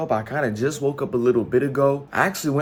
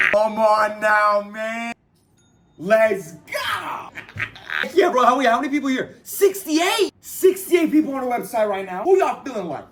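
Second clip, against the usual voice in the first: excited shouting; 7 semitones higher than usual. Real, not acted. Streamer cheering his first orders to viewers, excited shout. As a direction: whoop as the first orders land.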